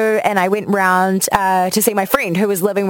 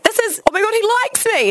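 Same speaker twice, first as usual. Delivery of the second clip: high, gushing mock squeal; giddy excitement; 14 semitones higher than usual. Insisting to a doubting friend about her crush, giddily high. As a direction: act out being lovestruck and convinced.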